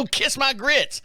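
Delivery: high sassy waitress voice; waitress doing Flo, high and sassy; Flo impression. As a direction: toss out Flo's catchphrase, high and cheeky. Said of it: "she looked like Flo from Mel's Diner".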